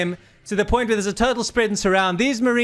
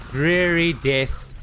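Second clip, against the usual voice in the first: ordinary talk, nothing marked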